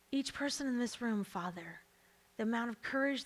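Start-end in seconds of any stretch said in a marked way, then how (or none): none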